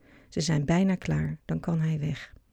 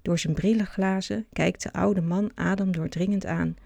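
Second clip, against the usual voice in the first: ordinary delivery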